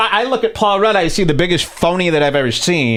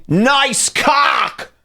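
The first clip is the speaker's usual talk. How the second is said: higher, shouted crude heckle; mock shout; 9 semitones higher than usual. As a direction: yell it like a rude comic. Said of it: "He would say stuff like that".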